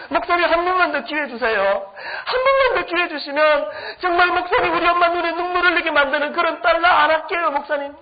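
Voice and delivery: high-pitched wailing plea, acted out; imitated tearful pleading. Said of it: "막 울면서".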